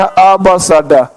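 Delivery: "अ, ब, स, ड" as short sounds; new letter sounds. Shown as a demonstration. Teacher showing the new way of reading. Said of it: "लेकिन अब पढ़ना".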